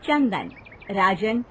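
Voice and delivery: plain reading voice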